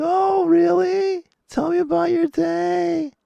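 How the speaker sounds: mocking partner. Husband mocking partner's greeting at home, sugary falsetto. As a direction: drag it out in a sugary fake-caring tone. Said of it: "They're like".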